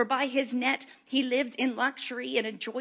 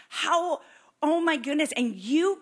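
exasperated outburst; 5 semitones higher, 5 dB louder than usual. Retold or imitated. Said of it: "Habakkuk is like".

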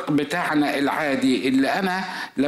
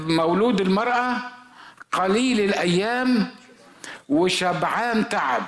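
solemn scripture recital; 3 semitones higher than usual. Acted. Scripture verse recited in sermon.

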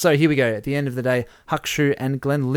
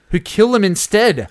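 excited reveal; 7 semitones higher, 5 dB louder than usual. Real, not acted. Host reveals an upset twist.

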